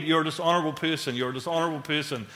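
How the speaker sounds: quick, repeated accusing refrain; nagging accusations. Acted. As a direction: rattle it off like looping thoughts. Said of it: "these accusations flowing around my head".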